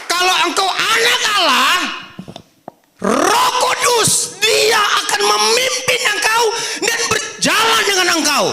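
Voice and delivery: high, loud and crying out; fervent preaching. Acted. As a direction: preach it with full force and feeling. Preaching at full force.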